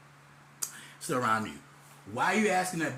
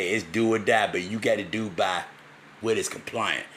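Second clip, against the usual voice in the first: lower mentor voice; 7 semitones lower, 3 dB louder than usual. Retold or imitated. Quoting mentor again.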